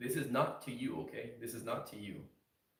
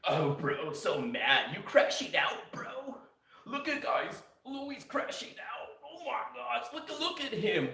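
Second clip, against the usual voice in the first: much higher, taunting; mocking troll viewers; 10 semitones higher than usual. Trolls taunt the streamer for crashing out, high and mocking. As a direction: gleefully jeer at someone losing it. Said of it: "Then they start going".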